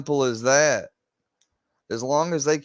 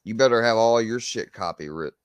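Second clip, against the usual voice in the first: usual speaking voice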